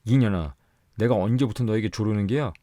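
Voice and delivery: loud, high roar like a tiger; husband roars at his fleeing wife; roaring rebuke. A narrator's reading. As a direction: thunder at her. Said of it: "범같이 호통을 치며".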